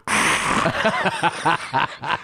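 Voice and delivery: high, breathy mock gasp; satisfied ah. Woman after swallowing, dazed satisfied gasp. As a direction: let out a dazed ah. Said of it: "she went".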